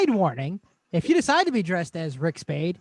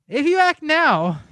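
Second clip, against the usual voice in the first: hammy pitch; 8 semitones higher, 5 dB louder, slower than usual. Acted. Hammed-up infomercial urgency.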